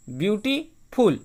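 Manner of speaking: Beautiful split slowly, pause before the final फुल; beautiful with a clear break before फुल; broken into syllables. Shown as a demonstration. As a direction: say beautiful in two separate pieces, beauty, then फुल. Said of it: "तोड़ के बोलते हैं".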